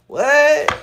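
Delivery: loud, high astonished what; sharp real surprise; surprised. Real, not acted. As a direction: caught off guard. Reacting to matching card.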